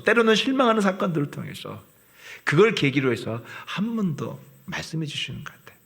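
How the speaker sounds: ordinary talk, nothing marked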